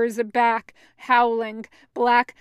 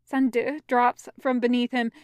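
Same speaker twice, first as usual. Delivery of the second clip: usual speaking voice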